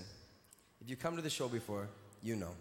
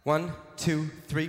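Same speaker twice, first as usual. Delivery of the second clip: loud count; 2 semitones higher, 8 dB louder, slower than usual. Real, not acted. Comic calls out to the crowd for a photo, loud. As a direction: belt the count to the back rows.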